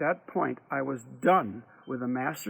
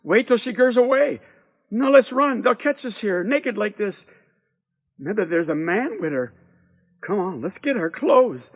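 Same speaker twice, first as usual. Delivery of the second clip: put-on anxious boys; 6 semitones higher, 6 dB louder than usual. Boys panicking, caught naked.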